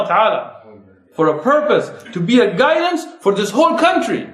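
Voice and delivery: rising, driving sermon voice; impassioned preaching. Acted. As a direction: rouse the congregation to action. Preaching call to da'wah.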